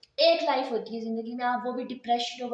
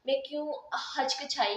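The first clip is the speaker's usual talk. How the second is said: normal talking voice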